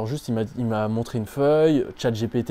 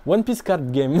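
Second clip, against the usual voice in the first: put-on English with a blédard accent, pitched higher; hammed-up broken tourist English; accent anglais blédard; 4 semitones higher, slower than usual. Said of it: "Je parlais comme un blédard". (accent anglais blédard, voix plus aiguë)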